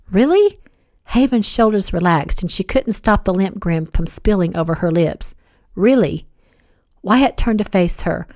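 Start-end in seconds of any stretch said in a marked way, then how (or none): 0.1-0.6 high, rising question; hopeful surprise. A narrator's reading. Haven hoping Wyatt is over Kat, hopeful question. 5.7-6.3 falling, settled confirmation; reassuring. A narrator's reading. Wyatt assuring Haven he's over Kat, reassuring. His confirming answer to her question.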